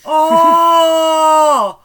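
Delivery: a loud, high, offended "Oh!"; outraged shout. Real, not acted. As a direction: yelp in mock outrage at the insult. Called a wild animal.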